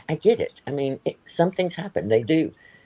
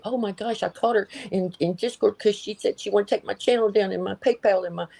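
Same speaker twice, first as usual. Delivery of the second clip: higher, mocking dramatic gossip voice; ex-friend mocked, telling everybody her story in a higher voice; mocking impression; 9 semitones higher than usual. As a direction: parody her breathless complaining. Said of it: "You love to tell everybody".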